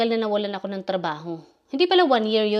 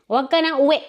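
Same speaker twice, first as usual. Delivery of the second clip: imitated boss snapping, louder and higher; angry employer; 5 semitones higher, 5 dB louder, faster than usual. Employer ordering her not to go home, mock angry. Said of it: "nagagalit sa akin".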